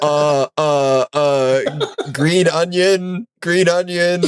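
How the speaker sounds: put-on high, slow, halting like a lost toddler; stammer, then sound out the words slowly in mock distress; helpless struggling reader. Pretends to struggle reading shopping list.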